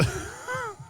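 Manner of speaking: quick high burst of laughter. Real, not acted. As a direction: let out a short high laugh. Laugh after sarcasm.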